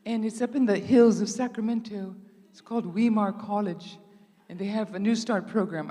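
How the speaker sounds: a bright, lilting Australian impression of the girl; Australian accent. Australian girl telling Lynn about her school, in an Australian accent. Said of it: "she had an Australian accent".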